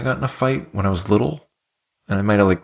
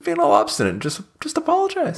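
mocking whiny voice. Mocking the angry brother.

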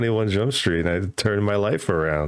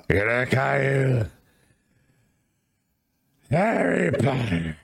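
mock wrestling boss. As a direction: play the pushy promoter pitching a gimmick. S1 plays Vince assigning roles.

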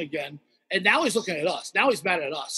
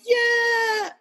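cheering. Retold or imitated. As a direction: whoop of relief. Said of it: "And I was like".

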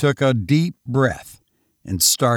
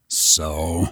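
gruff commissioner; 7 semitones lower than usual. Acted. Commissioner's low voice.

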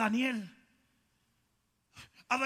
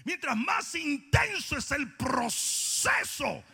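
fiery preaching; 5 semitones higher than usual. Acted. Preacher proclaims victory over music, fiery.

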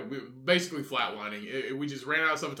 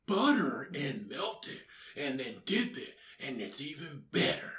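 slow, softer fat superhero voice; fat Batman; 8 dB quieter than usual. Acted. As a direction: savour each word slowly, quietly. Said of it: "you're fat Batman".

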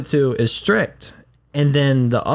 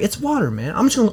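mock protest; 6 semitones higher than usual. Quoting his protest.

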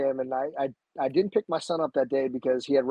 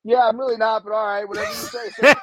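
mock nervous acceptance; 7 semitones higher, 7 dB louder than usual.

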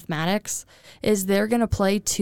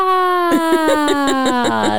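high giggle; 7 semitones higher, 5 dB louder than usual. Retold or imitated. Laugh after cheerleader chant.

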